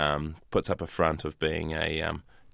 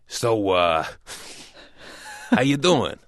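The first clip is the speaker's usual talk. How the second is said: movie-style American accent, drawn out and louder; 4 semitones higher, 4 dB louder, slower than usual. Shown as a demonstration. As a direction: play a movie American laying on a slow pickup line. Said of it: "Can you do an American accent".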